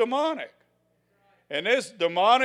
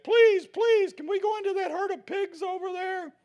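much higher, whiny begging demon voice; pleading demons; 14 semitones higher, 4 dB louder than usual. Acted. Demons begging Jesus to enter the pigs, high whiny plea. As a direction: squeal it like a desperate little demon.

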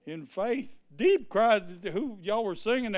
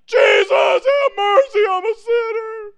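very high, loud mock wail; hammed-up wailing plea for mercy; squalling and bawling; 11 dB louder than usual. Preacher imitating a sinner begging Jesus, high bawling wail. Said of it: "you squall and bawl".

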